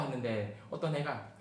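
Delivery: ordinary talk, nothing marked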